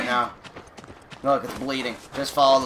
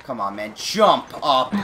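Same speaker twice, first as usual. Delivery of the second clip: ordinary talk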